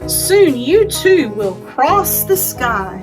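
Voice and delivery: ordinary talk, nothing marked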